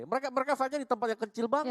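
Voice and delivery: rising hype as he points; excited. Real, not acted. Excited about the fight location.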